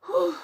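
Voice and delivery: high, loud exhaled whew. Real, not acted. Streamer braces to try new lashes, loud high whew. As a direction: blow out a big nervous whew.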